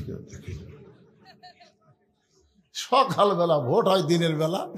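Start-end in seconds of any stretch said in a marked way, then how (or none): 0.1-0.6 hushed, voiceless aside; barely audible sneaky whisper; furtive whisper. Acted. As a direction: whisper it to yourself in secret. Man secretly relieved nobody saw him.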